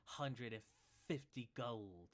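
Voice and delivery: slow hissing snake-man shopkeeper; serpent accent. Acted. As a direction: stretch every s in a slow serpent voice. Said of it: "in the serpent accent".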